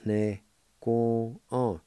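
"ne ko on" said slowly with every syllable spaced out; slow careful Cherokee. Shown as a demonstration. Teacher slowly modelling "ne ko on" for learners. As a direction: draw out "ne ko on" for beginners. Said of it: "it's pronounced like this".

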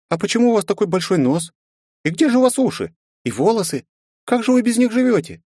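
put-on high, eager curious child; bubbly girl full of questions; little girl voice. Girl asks about his body.

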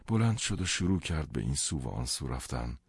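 ordinary delivery

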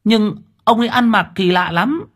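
put-on a boy describing a strange man; young boy answering. A narrator's reading.

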